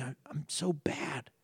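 breathy whisper, ashamed; a young man whispering his shame to himself; whispered confession. Retold or imitated. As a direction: whisper it like a secret you can't say aloud. Recalling shameful inner thought.

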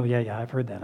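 flat, bored yeah yeah; bored dismissive. Retold or imitated. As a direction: brush it off like old news. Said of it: "that you go".

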